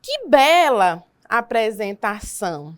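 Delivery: teacher models an exclamation with slow, loud delight. Shown as a demonstration. Slow, loud admiring exclamation for students. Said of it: "Exemplo aí, ó".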